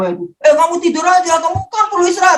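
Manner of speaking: high-pitched imitated telling-off; retold scolding. Friend on WA telling patient to rest, mock scolding. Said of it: "saya dimarahin loh di W A".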